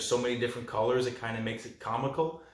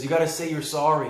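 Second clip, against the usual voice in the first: louder, higher mock command; insistent demand; 4 semitones higher, 5 dB louder than usual. Little girl to the monster, insistently demanding. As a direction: imitate a bossy little girl.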